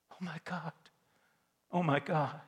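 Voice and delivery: very soft, barely voiced re-enactment; imitating a faint, overwhelmed plea; hushed anguished cry. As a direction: breathe it out quietly, as if the heart would burst. Voicing Jesus' heart ready to burst.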